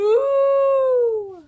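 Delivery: long, high, loud mooing cow. Acted. Mother reading to kids, a loud cow moo. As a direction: belt it out like a cow.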